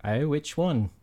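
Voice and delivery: a touch of Australian wife; light Australian lilt; Australian accent. Acted. [name] answering her husband, in a faint Australian accent. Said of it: "Can I do Australian?".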